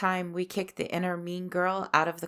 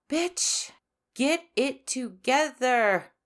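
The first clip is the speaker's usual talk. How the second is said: high, sassy self-scolding, building louder; scolding oneself; 6 semitones higher, 6 dB louder, slower than usual. Acted. Host acting out how we talk to ourselves, scolding. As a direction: give yourself a loud, drawn-out telling-off.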